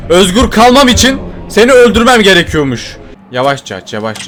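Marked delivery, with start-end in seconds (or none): 3.3-4.3 hushed caution. Real, not acted. Sneaking, opening door carefully.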